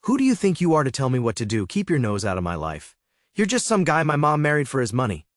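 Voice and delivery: put-on enraged teen screaming at stepfather before guests; yelling. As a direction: hurl every insult, yelling. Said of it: "began yelling at me".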